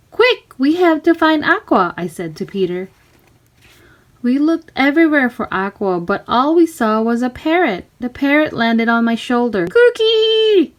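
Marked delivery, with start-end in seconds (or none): 0.1-1.9 higher, louder, urgent push. Acted. Girl urging her friend to search the ship, urgent higher voice. Urgent whisper-call to Peter. 9.7-10.7 much higher, louder squawk; parrot screech. Acted. Parrot on her shoulder, shrieking parrot squawk. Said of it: "it screamed".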